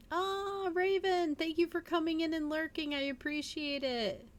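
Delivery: high, bright welcome to a viewer; excited greeting. Real, not acted. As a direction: greet them in a high, happy voice. Greeting a new viewer in chat.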